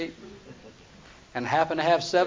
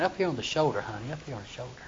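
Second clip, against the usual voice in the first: Samson asking softly; 5 semitones lower, 8 dB quieter, faster than usual. Retold or imitated. Samson asks for a massage.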